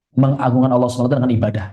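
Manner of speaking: ordinary delivery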